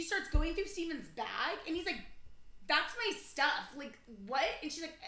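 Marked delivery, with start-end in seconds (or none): 2.7-4.5 loud, slow mock protest; imitated protest. Brother to woman in his bag, imitated protest. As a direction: push each word out in objection.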